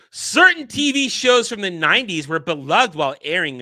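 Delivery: article read. Acted. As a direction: read it like a presenter. Reading article aloud.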